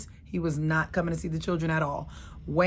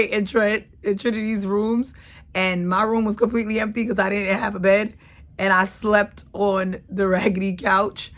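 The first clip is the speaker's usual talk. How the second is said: laughing; 4 semitones higher, 8 dB louder than usual. Real, not acted. Laughing telling of bed frames.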